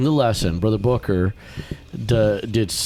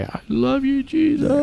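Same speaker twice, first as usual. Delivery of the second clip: put-on high child's prayer voice; little boy voice. Retelling a little boy praying at the altar, high child voice. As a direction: pray sweetly like a small boy. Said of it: "a little boy just pray".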